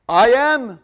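very loud, high, emphatic declaration; Jesus declaring. Acted. Jesus refusing to deny it before the priest, bold declaration. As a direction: ring it out with full force. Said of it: "He didn't deny it".

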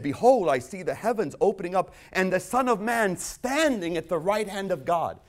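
a loud awed Stephen; Stephen's vision. Retold or imitated. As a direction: proclaim the vision with awe. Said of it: "And he said".

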